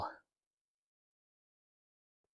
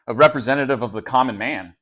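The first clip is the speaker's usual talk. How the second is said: normal talking voice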